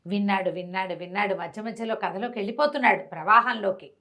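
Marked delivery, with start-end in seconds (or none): none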